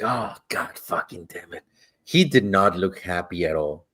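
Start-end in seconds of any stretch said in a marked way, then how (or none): 0.0-1.6 low, hushed retold curse; dismayed mutter. Passenger to himself on seeing the mad driver, dismayed mutter. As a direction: swear softly under your breath, much lower.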